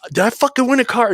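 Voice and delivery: high-pitched excited outburst, retold; going off. Winner at the casino machine, excitedly going off. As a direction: act out losing it over a big win. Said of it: "I just go off".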